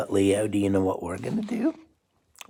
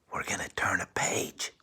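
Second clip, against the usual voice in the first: whispered, softer, conspiratorial; whispered plan; 4 semitones lower, 11 dB quieter than usual. Real, not acted. Parent whispering the plan to Leo. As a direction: whisper it like a shared secret.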